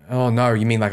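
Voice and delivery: ordinary delivery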